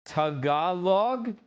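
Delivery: "Tagalog" as tuh-GAH-log, drawn out slowly; second-syllable stress. Shown as a demonstration. Streamer testing the new pronunciation, slow "Tagalog" as tuh-GAH-log. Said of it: "Now we got it".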